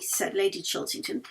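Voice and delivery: ordinary delivery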